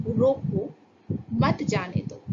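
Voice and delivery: रोको set apart, then मत जाने दो as one phrase; pause after रोको. Shown as a demonstration. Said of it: "हमने वहाँ पंक्चुएशन में सही तरीके से ध्यान नहीं दिया".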